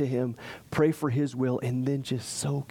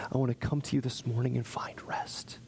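whispered prayer; 5 dB quieter than usual. Acted.

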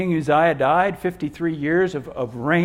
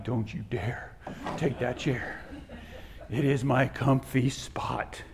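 low, hushed mock threat; soft, low-pitched playful menace; mock warning; 4 semitones lower, 7 dB quieter than usual. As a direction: warn quietly, half-joking and possessive. Acts out warning wife off chair.

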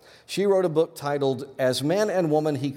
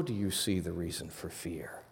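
whispering; 6 semitones lower, 11 dB quieter than usual. Acted. Hushed point about fear.

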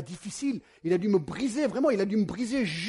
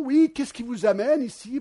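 higher, louder curious baker question; friendly baker; 6 semitones higher, 8 dB louder than usual. Retold or imitated. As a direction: ask brightly like the shop owner. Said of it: "baker asks why they came".

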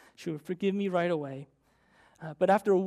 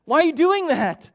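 high, loud shouted scolding; a father snapping at his daughter during bike practice, yelling; angry yell; 10 semitones higher, 12 dB louder than usual. Retold or imitated. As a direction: yell at the child in frustration. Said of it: "and I yelled".

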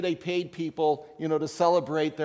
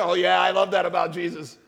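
mock churchgoer; 6 dB louder than usual. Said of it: "Don't, don't sit there and say".

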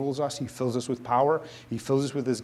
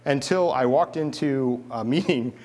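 usual speaking voice

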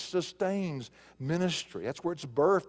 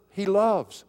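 LOVES hit hard, louder and higher; stress on the verb; 4 semitones higher, 5 dB louder than usual. Shown as a demonstration. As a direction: punch the word "loves". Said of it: "The emphasis is on the verb".

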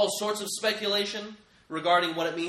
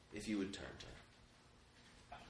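hushed whisper; 6 semitones lower, 17 dB quieter, slower than usual. Real, not acted. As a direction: drop to a whispered, slow plea. Invitation after loud proclamation.

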